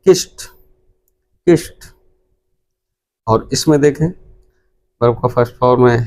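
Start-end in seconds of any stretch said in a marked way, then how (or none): 0.1-1.9 किस्ट said "kist", crisp t at the end, pitched higher; correct pronunciation. Shown as a demonstration. Teacher correcting students' कि्स्ड to किस्ट, higher. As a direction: stress the final t of किस्ट. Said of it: "इसको पढ़ा जाएगा".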